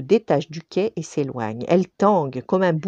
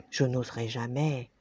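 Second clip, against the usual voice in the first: much softer shy reply; shy Paloma confessing; timid; 4 semitones lower, 8 dB quieter than usual. Acted. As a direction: barely dare to say it.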